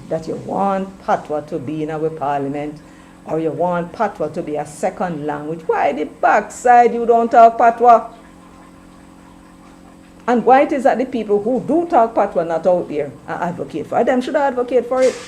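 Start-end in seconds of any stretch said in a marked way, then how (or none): 5.7-11.6 exasperated challenge. Real, not acted. Scolding patois advocates.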